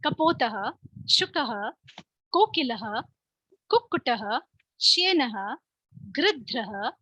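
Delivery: carefully recited Sanskrit names; slow recitation. Shown as a demonstration. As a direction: say each name slowly and clearly for pupils. Teacher drills animal names in Sanskrit. (धीमे, स्पष्ट उच्चारण में पाठ)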